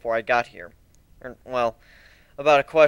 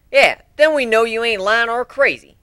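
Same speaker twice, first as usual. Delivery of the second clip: higher, louder country pony voice; Applejack adds; 6 semitones higher, 5 dB louder than usual. A narrator's reading. Applejack backs up Pinkie's demand, higher and louder. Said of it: "Applejack added".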